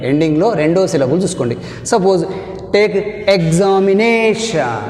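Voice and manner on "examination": examination, slow, stress on na; drawn-out examination with stress on -na-; stressed example word. Shown as a demonstration. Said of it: "Take one more example". (నా మీద ఒత్తిడి, నెమ్మదిగా)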